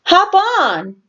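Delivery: high, changed character voice; playful character voice; Daddy Pig voice. A narrator's reading. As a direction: invite them on brightly.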